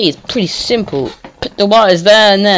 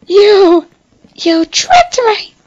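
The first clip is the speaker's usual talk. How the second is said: much higher, loud hammed-up outrage; mock betrayed victim; 4 dB louder, much slower than usual. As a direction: accuse the traitor theatrically. Playing betrayed character.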